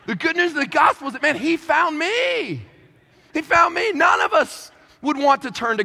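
high, impassioned preacher's declaration; preaching at full force. Acted. Preacher telling the crowd he found me, impassioned. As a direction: lift it like a triumphant proclamation. Sermon climax.

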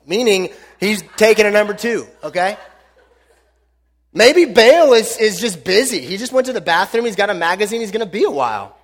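ordinary talk

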